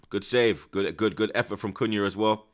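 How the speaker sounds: ordinary talk, nothing marked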